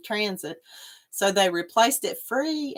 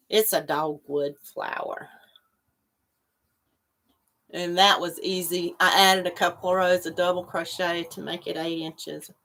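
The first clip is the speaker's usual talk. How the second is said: ordinary talk, nothing marked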